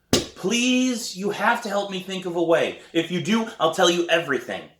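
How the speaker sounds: high, pleading plea to her brother; begging. A narrator's reading.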